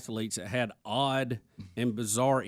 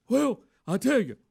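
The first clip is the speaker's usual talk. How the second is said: Bernie Sanders impression; 8 semitones higher than usual. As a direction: do a raspy old senator. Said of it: "And Bernie says".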